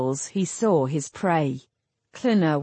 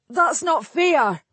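put-on high, slow, wailing outrage like a child; childlike howl; 8 semitones higher, 4 dB louder, slower than usual. A narrator's reading. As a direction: howl it like an indignant boy. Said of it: "a childlike howl of indignation".

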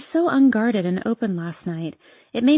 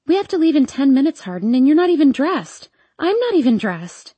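raised, higher pitched alarm; flustered panic; 5 semitones higher, 4 dB louder than usual. A narrator's reading. Girlfriend realizing they must leave soon, high and flustered. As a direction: rush in, high and urgent.

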